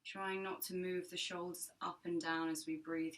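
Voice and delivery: calm, measured meditation voice with long pauses; guided meditation. Acted. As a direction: guide listeners gently into their breath, unhurried and even.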